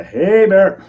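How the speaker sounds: high, loud, drawn-out holler; yelled warning call. Retold or imitated. Hunter warning off unseen eyes in the dark, yelling. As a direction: call out loudly to scare off an animal. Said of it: "Yell out again".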